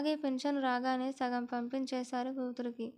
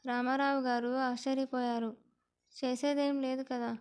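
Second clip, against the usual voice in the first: plain reading voice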